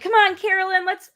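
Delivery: high, eager rooting; urging cheer. Retold or imitated. Viewer recalls rooting for a player in the challenge, urging cheer.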